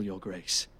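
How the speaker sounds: deferential hush. Acted. Servant addresses the king.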